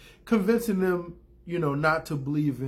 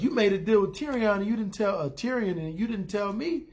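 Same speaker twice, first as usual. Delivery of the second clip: higher-pitched imitated accusation; mock indignant retort; 6 semitones higher than usual. Cersei turns the accusation back on Jaime, mock indignant. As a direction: throw the accusation back, hammed-up.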